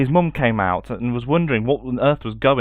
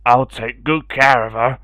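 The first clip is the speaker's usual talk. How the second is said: lightly voiced kind man, a bit louder; a hint of a gentle stranger; 5 dB louder than usual.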